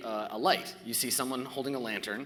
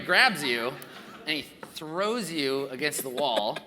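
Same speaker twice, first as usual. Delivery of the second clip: ordinary talk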